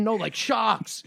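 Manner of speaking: high, slow Boston impersonation; thick Boston accent. Retold or imitated. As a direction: draw it out like an exasperated Bostonian. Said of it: "he's got a real thick Boston accent".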